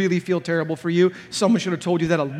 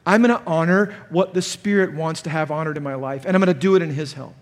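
ordinary talk, nothing marked

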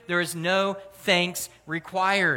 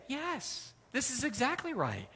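soft, higher-pitched acted reply; matter-of-fact agreement; 5 semitones higher, 9 dB quieter than usual. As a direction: answer softly, as if it's obvious. Voices Jesus' culture calmly agreeing.

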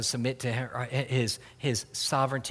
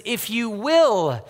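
If you will drawn out slowly, louder and higher; slow stress on if you will; 6 semitones higher, 7 dB louder, much slower than usual. Shown as a demonstration. Pastor stresses a leper's plea to Jesus, slow and loud. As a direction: lean hard on If you will, word by word. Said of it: "Do you notice those three words?".

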